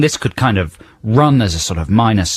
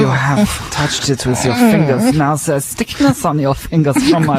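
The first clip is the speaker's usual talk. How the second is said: creepy singer parody; 5 semitones higher than usual. Acted. Mocking the song's creepy singer.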